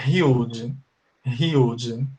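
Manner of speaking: "huge" with a breathy h, like hiudge; model pronunciation. Shown as a demonstration. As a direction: say "huge" with the h sounding like a Portuguese R. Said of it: "esse H tem som de R". ("huge" com o H soando como R)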